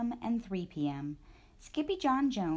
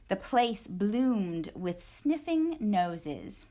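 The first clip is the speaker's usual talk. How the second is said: plain reading voice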